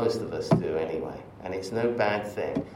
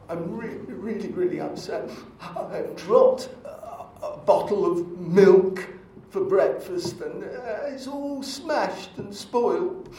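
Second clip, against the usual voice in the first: mock crying; 8 semitones higher than usual. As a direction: ham up a monk crying over spilt milk. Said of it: "a terrible impression of somebody crying".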